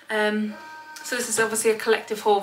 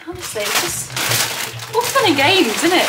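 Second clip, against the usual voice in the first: exasperated; 8 semitones higher than usual. Real, not acted. Dog goes crazy mid-filming.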